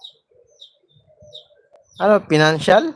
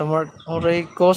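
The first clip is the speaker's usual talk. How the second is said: ordinary talk, nothing marked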